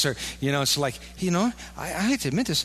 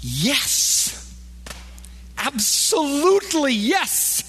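emphatic yes; 10 semitones higher, much slower than usual. Acted. Emphatic answer to question.

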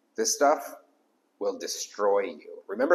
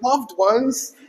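mock tender pastor, much higher; mock pastoral; 13 semitones higher, 8 dB louder than usual. Pastor mocks how he'd sound calling them loved ones, high. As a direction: croon it like a grand old pastor. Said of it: "if I was like".

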